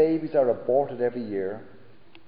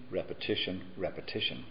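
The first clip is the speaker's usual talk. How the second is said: each repetition softer and lower, dying away; fading echo; 6 semitones lower, 9 dB quieter than usual. Acted. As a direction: let the word fade out like an echo. Repeating the word for effect.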